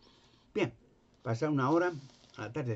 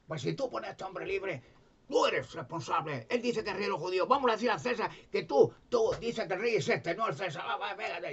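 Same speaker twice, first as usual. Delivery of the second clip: higher, pushy crowd of accusers; mocking crowd voice; 7 semitones higher than usual. The crowd threatening Pilate, higher and insistent. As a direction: press Pilate with a higher, goading voice.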